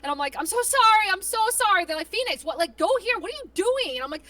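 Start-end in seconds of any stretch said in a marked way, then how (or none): 0.4-1.8 high, loud panicked apologizing; mock-frantic pleading for forgiveness; nervous apology. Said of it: "I get so nervous". 2.1-4.0 high, exasperated imitated scolding; mock an irate teammate giving orders; bossy teammate. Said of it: "getting bossed around so hard".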